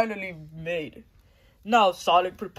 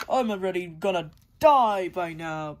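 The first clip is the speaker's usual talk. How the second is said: ordinary talk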